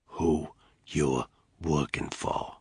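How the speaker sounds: slow, soft and menacing; deathly quiet. Acted. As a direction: hush it, slow and deadly. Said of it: "in a deathly quiet voice".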